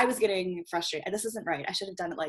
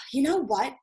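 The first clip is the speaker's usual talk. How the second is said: big-breath sigh; 6 semitones higher than usual. Acted. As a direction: exhale heavily before finally owning up. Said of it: "big breath and you're like".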